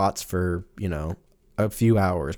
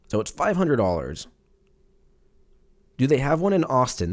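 normal talking voice

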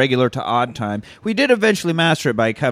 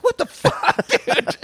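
disbelief; slower than usual. Retold or imitated. Retold annoyed castmate.

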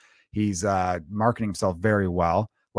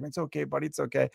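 soft, much quieter reassurance; soothing dad; 3 semitones higher, 6 dB quieter than usual. Retold or imitated. Dad comforting his vomiting six-year-old, soft soothing reassurance. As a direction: hush him gently while rubbing his back.

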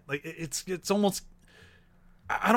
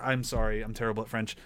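ordinary talk, nothing marked

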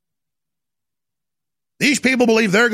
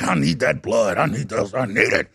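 much lower, menacing mock demon voice; growling demon; 12 semitones lower than usual. Host mocking a senator as a blood-craving demon. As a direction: growl it like a blood-hungry ghoul. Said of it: "he's like".

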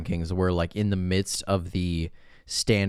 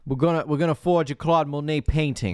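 raised mock Boston accent; 7 semitones higher than usual. Co-host mimicking Travolta planning the forgery, mock Boston. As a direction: put on a broad comic Boston accent. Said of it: "I can't even do his accent".

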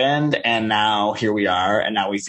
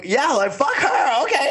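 catty mock voice. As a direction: sneer it in a high put-on voice. Mocking a catty friend.

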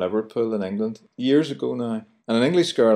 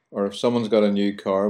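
normal talking voice

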